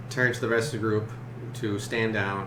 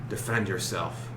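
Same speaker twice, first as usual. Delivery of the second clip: werewolf; 3 semitones lower, 4 dB quieter than usual. Acted. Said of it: "in a gruffer, grizzly more voice".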